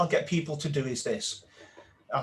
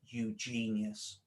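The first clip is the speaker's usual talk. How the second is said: slow, soft, drawn-out repetition; self-affirmation mantra; 6 semitones lower, 9 dB quieter, slower than usual. Shown as a demonstration. Coach showing the inner mantra, softly and slowly. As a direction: murmur it to yourself like a mantra. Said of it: "start repeating in your head".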